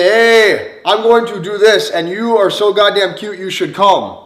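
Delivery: loud, upbeat, bold invite with a grin; energetic invitation. Shown as a demonstration. Coach demos inviting a cute woman, loud and upbeat. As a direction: sell it with big energy. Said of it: "it's the tone and the energy".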